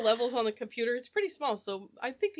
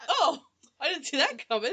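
surprised; 4 semitones higher, 5 dB louder than usual. Retold or imitated. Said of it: "And I was like".

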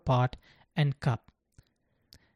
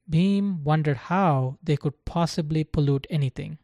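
plain reading voice

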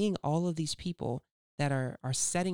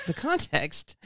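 radio voice; 3 semitones higher, 5 dB louder, slower than usual. Shown as a demonstration. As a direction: announce it like a radio host. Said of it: "I got my radio voice".

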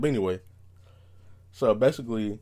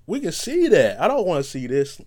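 exasperated; 6 semitones higher, 4 dB louder than usual. Retold or imitated. Complaining at retweeters.

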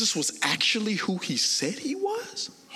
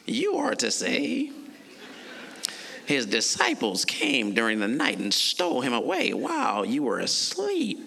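a lightly voiced scheming Pharisee; 8 semitones lower than usual. Chief priests instructing the soldiers, lightly voiced Pharisee. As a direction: give the guards their orders as a sly Pharisee. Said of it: "let me put on my Pharisee voice".

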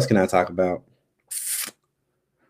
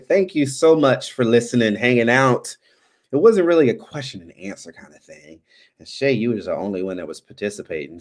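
ordinary delivery

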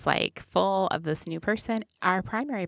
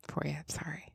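whispered; 12 dB quieter than usual. Real, not acted. Teasing aside.

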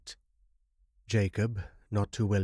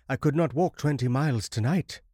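higher, louder, urgent protest; agitated protest; 6 semitones higher, 4 dB louder than usual. A narrator's reading. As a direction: plead against the distance. Stranded traveller despairs to host.

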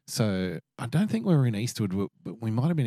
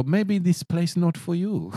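put-on higher, slow Vietnamese-accented broken English; imitating the waitress; 9 semitones higher, 3 dB louder, slower than usual. Waitress ushering them out, mock Vietnamese accent. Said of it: "And she go".

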